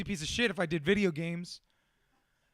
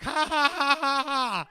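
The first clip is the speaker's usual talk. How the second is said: higher, louder mock cackle; mocking laugh; 5 semitones higher, 6 dB louder, slower than usual. Imitating Donna laughing at his seriousness, mocking laugh. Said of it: "She's like".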